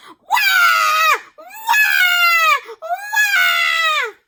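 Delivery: put-on very high loud baby wail; baby yelling. A narrator's reading. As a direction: scream as hard as a baby can. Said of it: "yelling as loudly as possible".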